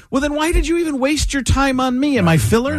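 high-pitched, aggrieved husband retort; mock exasperation. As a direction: re-enact an indignant marital complaint, voice up. Acted exasperation in marital quarrel.

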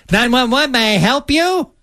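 high, drawn-out mock operator; mock dispatcher. Host mocking the reenactment's dispatcher, high mock imitation. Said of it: "She said".

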